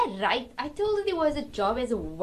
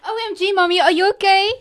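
loud, high alarm; panicked; 9 semitones higher, 7 dB louder than usual. Acted. Daughters react as their mother faints, loud and alarmed. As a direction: rush in as mommy faints.

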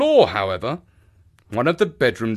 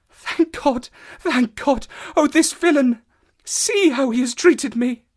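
high, impassioned female character voice; distraught woman; 14 semitones higher than usual. Acted. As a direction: cry out in relief turning to outrage. Voices Mrs Stapleton's outburst.

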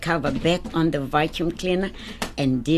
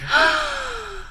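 high, drawn-out gasp; delighted gasp. Acted. Toby sees mum's ring, high amazed gasp.

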